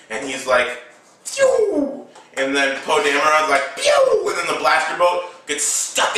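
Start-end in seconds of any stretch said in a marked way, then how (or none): 1.2-2.1 very high falling "pew"; imitated blaster shot. 3.8-4.3 high laser zap; imitated blaster shot.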